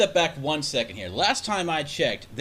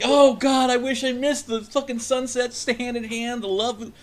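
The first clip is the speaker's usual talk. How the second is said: high, hammed-up swooning voice, louder at the start; mock romantic gushing; 11 semitones higher, 4 dB louder than usual. Host mocks a romantic partner's sunset fantasies in a hammed-up swooning voice. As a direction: camp it up like a lovesick dreamer.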